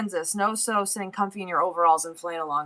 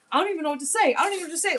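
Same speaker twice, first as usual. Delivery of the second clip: stunned; 9 semitones higher, much faster than usual. Retold or imitated. Son quoted, stunned.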